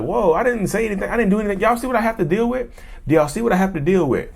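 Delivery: hammed-up wounded innocence to onlookers; playing the victim. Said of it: "I'm, I'm playing the victim".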